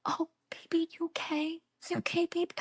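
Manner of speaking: put-on hushed, high baby-soothing whisper; gentle whisper. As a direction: whisper softly like comforting a baby. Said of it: "she goes".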